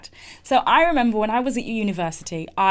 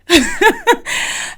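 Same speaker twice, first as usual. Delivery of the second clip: high, loud burst of laughter; laughing; 11 semitones higher, 8 dB louder than usual. Real, not acted. Woman laughing at her memory of crying at work, amused. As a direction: laugh out loud at your own story.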